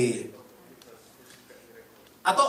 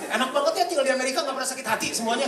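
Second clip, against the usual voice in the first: sarcastic teasing; 7 semitones higher, 5 dB louder, faster than usual. Pretend. Teasing congregation about never hurting.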